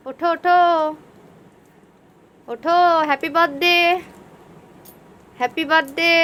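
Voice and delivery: calling out to wake someone. Real, not acted. Waking husband at midnight.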